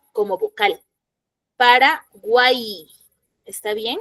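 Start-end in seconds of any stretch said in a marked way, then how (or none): 1.6-2.9 "Paraguay" said slowly, syllable by syllable, ending in an "ai" vowel glide; "Paraguay" stretched out for the class, pa-ra-guai; slow model word. Shown as a demonstration. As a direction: spell out "Paraguay" slowly so the final y sounds like the vowel i. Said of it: "Cuando yo pronuncio la palabra Paraguay".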